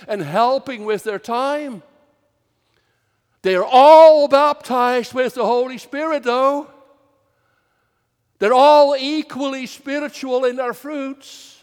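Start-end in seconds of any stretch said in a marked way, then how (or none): 3.4-11.5 emphatic preaching. Real, not acted. Preacher driving home his point.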